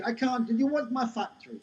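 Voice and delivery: high, put-on Jamaican old woman; crap Jamaican accent. Seventy-year-old owner giving up, high mock Jamaican accent. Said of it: "This is my crap Jamaican accent".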